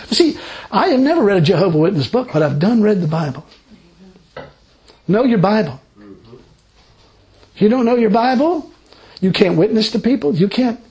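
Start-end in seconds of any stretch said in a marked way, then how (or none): none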